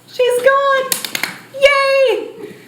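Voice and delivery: high, bright little cheer; gleeful cheer. Acted. Disorder cheering that depression is gone, gleeful and high. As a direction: celebrate like a child.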